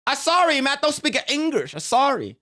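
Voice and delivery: high, pretending to speak no English; fake no-English excuse. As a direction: brush him off, playing a foreigner. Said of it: "It's like".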